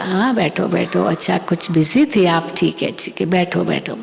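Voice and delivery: gentle, accepting reassurance in a settled low voice; loving, retold. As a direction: imitate a mother kindly waving off an apology. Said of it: "मम्मा ने उसको बहुत प्यार किया".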